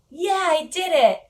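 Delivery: proud mock whoop after her first session; excited cheer. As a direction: replay her little victory shout. Triumphant retold self-quote.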